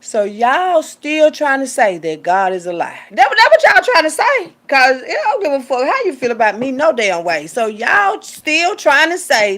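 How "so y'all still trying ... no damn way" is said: loud, high fervent sermon; impassioned preacher; preaching. Real, not acted. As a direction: preach it hard.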